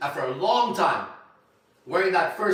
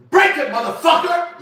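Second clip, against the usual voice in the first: full-force high, drawn-out yell; re-enacted yell; 13 dB louder than usual. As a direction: yell it out like a dare across the gym. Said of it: "I yell out".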